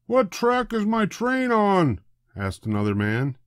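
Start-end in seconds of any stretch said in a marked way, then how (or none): 0.1-2.0 high, slow puzzled question; confused passenger. A narrator's reading. Second passenger puzzling over the board. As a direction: ask in a fretful high voice.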